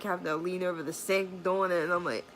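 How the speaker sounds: normal talking voice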